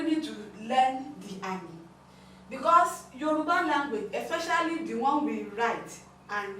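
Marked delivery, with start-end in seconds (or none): none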